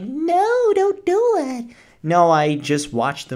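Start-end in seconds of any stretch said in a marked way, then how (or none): 0.0-1.7 mock scared scream. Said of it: "scream and be like".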